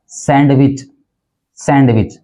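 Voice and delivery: सैंडविच with a sounded D; सैंडविच as SAND-wich; common mispronunciation. Shown as a demonstration. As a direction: pronounce the D in सैंडविच. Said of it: "गलत उच्चारण कर देते हैं".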